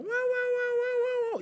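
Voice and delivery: loud, high-pitched "wow wow" wailing; siren-like mock wailing, full volume; mimicked nagging wail. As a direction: imitate an endless high nagging noise. Mimics wife's nagging outburst.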